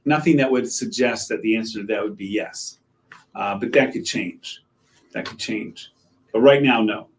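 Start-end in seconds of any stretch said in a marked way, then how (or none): 5.1-5.9 unvoiced whisper, much softer; whispered aside. Shown as a demonstration. Weatherman whispering a caveat to viewers. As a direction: breathe it as a private aside.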